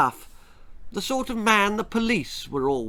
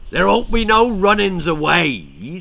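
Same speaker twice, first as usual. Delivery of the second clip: louder, rough farmer with a smile; smiling farmer; 4 semitones higher, 7 dB louder than usual. A narrator's reading. Farmer smugly tells the children they cannot run, gloating. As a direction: gloat it like a sly yokel. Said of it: "smiled the farmer".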